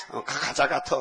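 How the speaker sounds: normal talking voice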